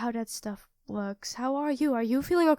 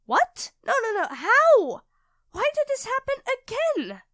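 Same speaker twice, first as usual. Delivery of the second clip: high, loud, frantic disbelief; panicked; 10 semitones higher, 7 dB louder than usual. Acted. Panicking to her partner over the impossible cake, frantic shrieks.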